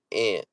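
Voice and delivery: "aunt" said like "ant"; ant pronunciation. Shown as a demonstration. Reading out a Midwest viewer's version, "aunt" said as "ant". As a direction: say "aunt" with the a of "cat". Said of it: "how you say it".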